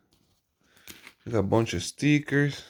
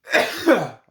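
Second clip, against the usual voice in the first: sudden sharp sneeze; 10 semitones higher, 3 dB louder than usual. Real, not acted. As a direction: let out a sneeze. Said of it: "I have to sneeze".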